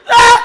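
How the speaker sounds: very high, very loud holler; sudden holler. Acted. Brother hollering for no reason, high piercing yell. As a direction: imitate a brother hollering out of nowhere. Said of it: "what the fuck he hollering for".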